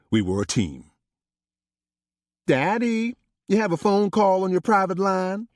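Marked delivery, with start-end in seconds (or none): 2.5-5.5 put-on much higher, young daughter's voice; a grown daughter calling her father from the doorway. Paris tells Daddy he has a call, in a high, girlish voice. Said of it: "Paris said from my office door".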